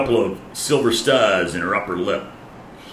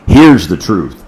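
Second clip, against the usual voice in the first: louder punch on here's the truth; emphatic repeat; 2 semitones lower, 10 dB louder than usual. Shown as a demonstration. Preacher to congregation, louder emphatic repeat. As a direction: hammer it home. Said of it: "repeated for emphasis".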